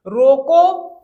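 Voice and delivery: "रोको" loud and standing alone, then halt; stop after रोको. Shown as a demonstration. Teacher shows where to halt, pause after "रोको". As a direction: punch "रोको" and hold a pause. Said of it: "कुछ आपको ठहरना है".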